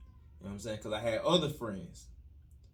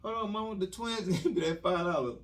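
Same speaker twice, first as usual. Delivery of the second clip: put-on pleading kid; 6 semitones higher, 4 dB quieter than usual. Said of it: "I was like".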